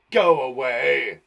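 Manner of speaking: loud, slow menacing shadow; hissing shadow. Acted. Shadow telling someone to go away, drawn-out menacing hiss. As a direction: draw it out as a scary shadow. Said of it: "The shadow hissed".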